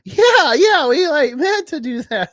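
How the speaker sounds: very high, loud excuse; mock defensive. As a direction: squeal it like a cover-up.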